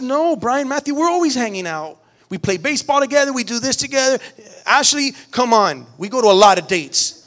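firm refusal. Retold or imitated. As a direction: raise your voice and tell them no. Dad refusing the other kids.

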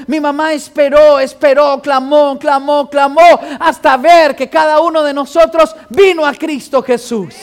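loud, proclaiming preacher's cadence, hammering each repeated verb; preacher proclaiming to the congregation how his mother prayed, loud and fervent; preaching at full force. Acted.

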